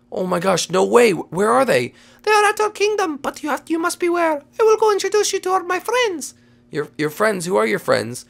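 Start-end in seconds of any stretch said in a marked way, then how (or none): 2.2-6.3 very high little creature voice; rabbit guide. Pretend. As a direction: give the warning in a squeaky rabbit voice. Rabbit warns the players.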